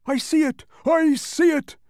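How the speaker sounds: high, loud, gleeful shout; happy cry. Acted. The happy bug shouting with glee at the castle, excited. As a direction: bounce with joy as you shout it. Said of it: "said the happy bug".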